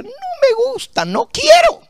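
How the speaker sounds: put-on very high, wailing child voice; whiny crying child. Preacher retelling his childhood, whining as a little boy. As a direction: play the kid refusing his food. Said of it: "pataleaba y lloraba y decía".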